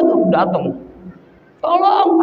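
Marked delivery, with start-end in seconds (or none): 1.6-2.2 high call for help; mock cry for help. Herder calls out but no villager comes, mock cry for help. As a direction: act out an unanswered plea, pitched high. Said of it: "Baru teriak lagi tuh".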